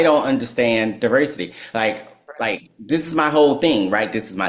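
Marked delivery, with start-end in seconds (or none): none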